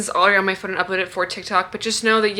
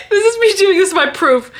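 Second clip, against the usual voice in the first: laughing; 9 semitones higher, 4 dB louder than usual. Real, not acted. Laughing at her own clip.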